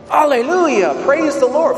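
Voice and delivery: high-pitched retold hallelujah cry; imitated ecstatic outcry. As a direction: mimic a worshipper crying out in joy. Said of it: "makes her cry out all the more".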